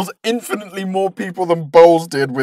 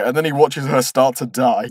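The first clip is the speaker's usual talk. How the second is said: usual speaking voice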